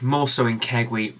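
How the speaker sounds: usual speaking voice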